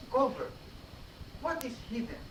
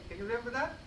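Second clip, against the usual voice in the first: ordinary talk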